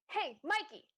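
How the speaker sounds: loud, high call to a servant. Acted. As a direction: shout for him across the house. Calling a servant.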